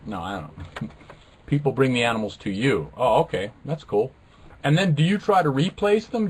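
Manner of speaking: ordinary talk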